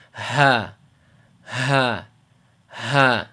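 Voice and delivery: separate, heavy breaths of "ha" falling away; exaggerated sighed H. Shown as a demonstration. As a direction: overdo the H in each "ha" from the chest. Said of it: "I'm over exaggerating a bit".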